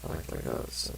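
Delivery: soft unvoiced whisper; hushed whispered aside. Real, not acted. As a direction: breathe it out under your breath. Aside while reading.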